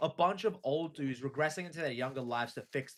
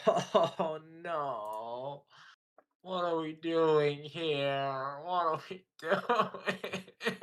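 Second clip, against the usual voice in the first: slow appalled laughing disbelief; disgusted laughter; much slower than usual. Real, not acted.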